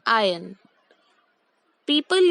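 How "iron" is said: आयन said "आयन" with no r; teacher's model: "आयन" without an r sound; correct pronunciation. Shown as a demonstration. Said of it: "I repeat". (सही उच्चारण: iron को "आयन" बोलें, R silent)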